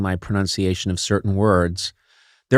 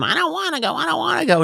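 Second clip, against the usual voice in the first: put-on very high-pitched child voice; high, childish protest; whining kid; faster than usual. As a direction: play a kid refusing to go. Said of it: "you're talking to a kid".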